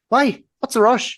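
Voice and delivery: high, loud exasperated questions; indignant protest. Retold or imitated. Sleepy sibling hurried out of bed, retold indignant protest.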